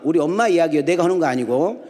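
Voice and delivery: normal talking voice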